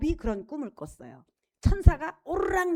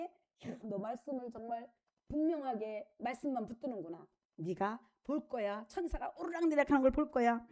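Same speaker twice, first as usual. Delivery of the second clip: much softer, gentle praise; Jesus quietly delighting in a faithful man, much softer; tender Jesus; 4 semitones lower, 7 dB quieter than usual. Acted. Jesus praising Nathanael gently, much softer.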